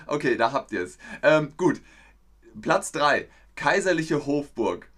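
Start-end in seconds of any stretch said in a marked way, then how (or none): none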